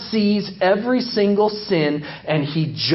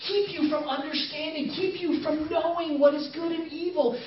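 higher, hushed coaxing serpent voice, softer; tempting Satan whisper; 10 semitones higher, 5 dB quieter than usual. Acted. Satan whispering to Eve in a skit, hushed and coaxing. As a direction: lean in and tempt her quietly. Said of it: "I'm whispering in Eve's ear".

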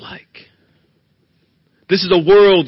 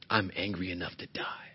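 breathy whisper of bitter last words; 8 semitones lower, 16 dB quieter than usual. Acted. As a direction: let it fade out as a whisper. Sad last words of Jonah.

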